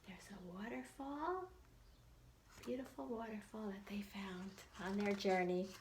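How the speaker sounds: hushed whispered aside. Real, not acted. Teacher showing kids the waterfall in a whisper. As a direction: whisper it like a secret.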